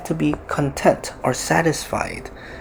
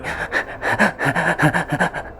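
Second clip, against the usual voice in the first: acted stuttering sobs; mock crying in quick wordless bursts; put-on weeping, pulsing out in little sobs; tearful sobbing; 3 semitones higher than usual.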